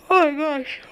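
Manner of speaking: words stretched through a big yawn; a real yawn swallowing the words. Real, not acted. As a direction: say it mid-yawn. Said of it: "sorry for yawning".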